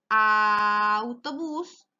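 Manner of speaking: slow, drawn-out sounding out; slow syllable by syllable. Shown as a demonstration. Teacher stretching the word for a young learner, slowly. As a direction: lay the syllables out one by one. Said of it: "Comienza con l".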